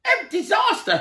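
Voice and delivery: very high, loud terrified scream. A narrator's reading. Mister Jelly waking to the tramp's face, high terrified scream. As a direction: shriek at the giant's face. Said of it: "he screamed".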